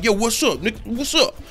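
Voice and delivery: high, chummy hello; mock friendly greeting. Imitating a too-friendly shove as a casual hello. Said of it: "push him like".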